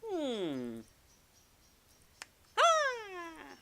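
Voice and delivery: put-on high little-boy pondering, then loud eureka; squeaky boyish hum bursting into a cry; Luke. Little boy deducing his puzzle answer, excited. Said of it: "you've got Luke, who, little boy".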